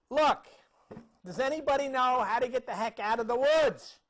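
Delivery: loud, high shout for directions; yelling out. Acted. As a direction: holler into the woods for help. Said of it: "he's yelling out".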